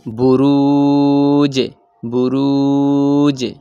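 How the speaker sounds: slow recited বুরুজে, the জ echo falling; recited qalqala. Shown as a demonstration. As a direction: chant বুরুজে slowly, dropping the জ. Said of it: "উচ্চারণ নিম্নমুখী হবে".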